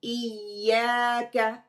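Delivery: इय्याका slow and loud, doubled य held firmly; slow firm recitation. Shown as a demonstration. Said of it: "मजबूती से शब्द को पकड़ेंगे".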